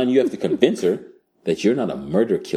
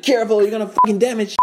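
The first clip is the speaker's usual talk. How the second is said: higher, urgent mock warning; 13 semitones higher, faster than usual. Hero warning the woman mid-fight, high urgent mock voice. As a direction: warn her in a high voice. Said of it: "She's like".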